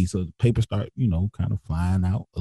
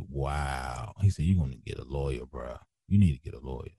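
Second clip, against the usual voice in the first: low, much softer imitated reaction; hushed amazement; 9 semitones lower, 10 dB quieter than usual. Homeboy advises getting a lawyer, retold in hushed amazement. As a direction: drop low and quiet, as if stunned for the friend. Friend's stunned advice, re-enacted.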